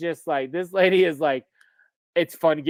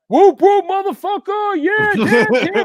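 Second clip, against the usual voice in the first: much higher, loud whooping party voice; rowdy juggalo hype; 8 dB louder than usual. Pretend. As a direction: hype them up like a wild clown fan. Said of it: "picking 'em up like".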